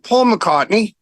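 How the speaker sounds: put-on lilting English-accented self-introduction. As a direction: imitate a British caller giving his name. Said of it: "there's an English, you know, person".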